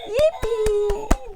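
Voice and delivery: high, loud drawn-out cheer; Peppa cheering joyfully. A narrator's reading. Teacher repeats Peppa's cheer before translating, gleeful shout. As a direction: shout it out with glee. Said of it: "diye neşeyle".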